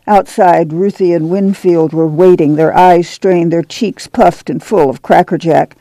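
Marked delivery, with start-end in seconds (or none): none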